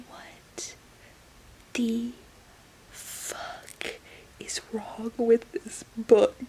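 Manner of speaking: real stunned whisper; disgusted whisper. Real, not acted. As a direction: hiss it under breath in disbelief.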